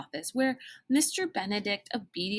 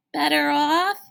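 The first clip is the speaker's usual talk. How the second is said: stunned echo; 8 semitones higher, 8 dB louder than usual. A narrator's reading. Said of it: "Sticky echoed".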